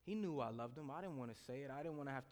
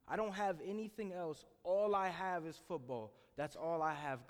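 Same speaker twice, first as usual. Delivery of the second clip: higher, louder pleading prayer; desperate plea; 4 semitones higher, 6 dB louder than usual. Retold or imitated. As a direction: cry out to God at rock bottom. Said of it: "praying to God and saying".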